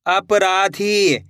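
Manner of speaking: slowly joined syllables; slow blend. Shown as a demonstration. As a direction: draw the word out syllable by syllable. (धीरे धीरे जोड़कर)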